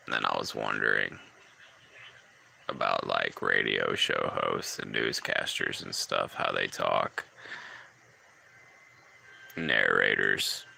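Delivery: low creaky vocal fry. Shown as a demonstration. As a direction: keep it low and creaking throughout. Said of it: "I'm talking in vocal fry right now".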